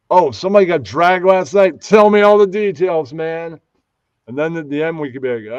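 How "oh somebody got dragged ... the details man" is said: loud, high, eager prodding; excited host. Shown as a demonstration. Pitching a show format to Ross, excited host demo.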